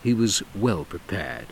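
ordinary delivery

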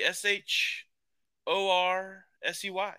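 slow, letter-by-letter spelling. Shown as a demonstration. Spells out the corrected name.

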